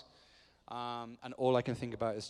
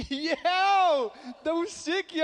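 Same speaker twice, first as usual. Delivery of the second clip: very high mock-excited yell; over-the-top bro greeting, pitched way up; hammed-up enthusiasm; 14 semitones higher, 6 dB louder, much slower than usual. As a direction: fake being thrilled to remember. Acts overexcited reply to a stranger.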